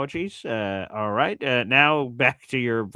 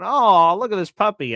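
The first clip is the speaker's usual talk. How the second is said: higher, louder mock coo over a puppy; 7 semitones higher, 7 dB louder than usual. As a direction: gush over the puppy, hammed up. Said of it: "everyone's going".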